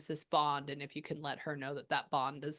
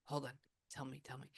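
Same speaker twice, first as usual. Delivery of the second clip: much softer, under the voice; hushed aside; 3 semitones lower, 9 dB quieter, faster than usual. Real, not acted. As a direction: murmur it privately to a spirit. Muttered aside to the spirit.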